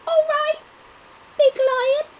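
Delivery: very high, loud squeak of a tiny mouse; squeaky mouse. Acted. Mouse answers the big lion in a squeak. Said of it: "squeaked the little mouse".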